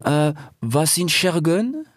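high, slow, tentative question; cautious meerkat. A narrator's reading. Meerkat asks what a word means, cautious high voice. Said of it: "fragte eines der Erdmännchen vorsichtig".

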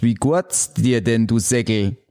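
put-on slow, careful Swabian greeting to an old buddy. Shown as a demonstration. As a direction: spell out the Swabian greeting slowly. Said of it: "Begrüßung eines alten Kumpels auf Schwäbisch". (langsamer schwäbischer Gruß)

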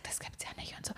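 whispered disbelief. Retold or imitated. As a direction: breathe it out in shock. Flüsternd nachgespielte Reaktion. (ungläubig geflüstert)

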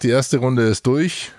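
ordinary talk, nothing marked

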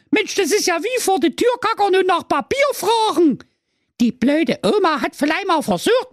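very high, scoffing fairy voice; evil fairy, scornful. Acted. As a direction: scold mockingly as a wicked fairy, starting with a laugh. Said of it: "die böse Fee lachte bloß". (sehr hohe, höhnisch keifende böse Fee)

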